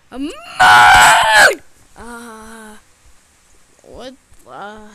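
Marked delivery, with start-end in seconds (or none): 0.1-1.6 loud, drawn-out mock-angry shout; mad. Answering "what are you gonna do", loud mad shout. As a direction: wail it at full volume.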